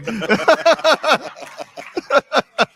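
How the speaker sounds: high squealing laugh. Real, not acted. Guest laughing at her reply to mom, high squeal.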